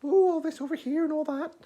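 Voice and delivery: very high, airy mock princess voice; hammed-up sweet and delicate falsetto; mock soft-spoken girl. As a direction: put on a dainty, high-pitched voice. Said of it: "not sort of soft-spoken".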